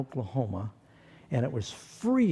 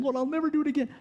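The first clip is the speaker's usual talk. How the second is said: high, desperate imitated plea; mock pleading; 12 semitones higher, much faster than usual. Seasick sailors confessing to God in the storm, high desperate plea. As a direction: beg the Lord like a scared sailor.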